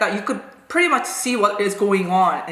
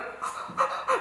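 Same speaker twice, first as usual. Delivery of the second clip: softer, voiceless acted gasp; shocked gasp; 10 dB quieter than usual. Pupil reeling from the teacher's slap, hushed shocked gasp. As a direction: suck in air in mock shock. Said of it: "like that, I remember".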